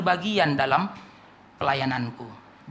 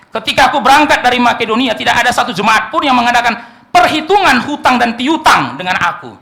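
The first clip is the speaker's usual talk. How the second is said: scripture reading; 5 semitones higher, 8 dB louder than usual. Acted. Reads scripture verse aloud.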